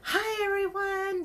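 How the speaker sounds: high, loud and slow bubbly greeting; imitating Danielle. Imitating the seller greeting her live audience, bubbly and high. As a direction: copy a peppy host's hello. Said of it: "she's just like".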